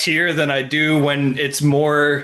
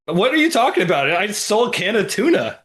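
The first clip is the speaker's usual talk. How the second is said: baffled protest; 6 semitones higher than usual. Retold or imitated. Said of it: "They'd be like".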